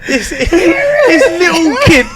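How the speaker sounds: high, loud, helpless laughter through the words; laughing hard. Real, not acted. Dad telling how his kid copied the insult, cracking up. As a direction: struggle to get it out for laughing.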